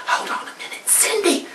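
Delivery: hushed whisper; whispered to her friend on the phone. Acted. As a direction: whisper so the witch won't hear. Girl hushes friend before door.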